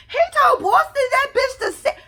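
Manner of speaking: loud, high-pitched squeal through laughter; laughing disbelief. Real, not acted. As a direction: burst out with the scandalous reveal. Reveals the shocking twist, laughing.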